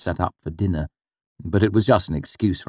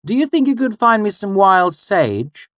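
higher call across the camp; 5 dB louder than usual. Acted. The mother calling to her son, fond and raised. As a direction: call the boy over fondly. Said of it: "my mother called to me".

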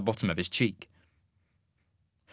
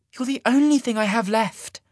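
heartfelt; 12 semitones higher, 4 dB louder than usual. A narrator's reading. Sasha confessing.

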